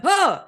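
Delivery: loud, high sudden gasp; startled gasp. Retold or imitated. Wife retelling Joel walking in the room, loud startled gasp. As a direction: imitate jumping in fright. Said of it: "I get scared twenty-four seven".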